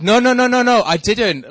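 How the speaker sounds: high, loud, rapid-fire denial; frantic protest. Retold or imitated. Beginner skier correcting the delighted instructor, frantic protest.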